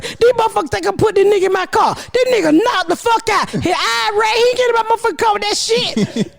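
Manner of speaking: high, fast indignant rant; retold rant. Mother refusing to put her son in her car, high rant. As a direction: fire it off high and outraged.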